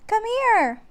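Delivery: high, loud call; a girl calling her brother over. A narrator's reading. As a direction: call out from under the tree. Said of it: "called Annie".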